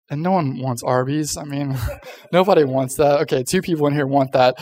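normal talking voice

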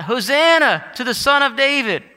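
loud, high acclamation; joyful crowd cry. Retold or imitated. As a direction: call it out like a cheering crowd. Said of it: "the crowds are crying out".

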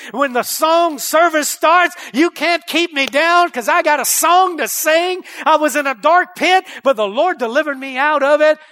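loud, rising sermon delivery; preacher at full cry; full-force preaching. Acted. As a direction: drive it home to the congregation.

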